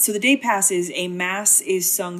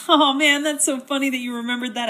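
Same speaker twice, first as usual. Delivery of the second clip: gushing Raoul; 7 semitones higher, 3 dB louder than usual. Retold or imitated. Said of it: "And he's like".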